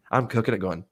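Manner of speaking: ordinary delivery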